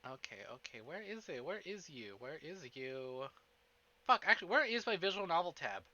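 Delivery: ordinary talk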